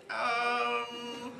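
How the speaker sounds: put-on high, bewildered drunk calling out; a lost drunk pleading with the car; drunk confused voice. Drunk driver pulled over calling OnStar, high confused voice. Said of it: "So I was like".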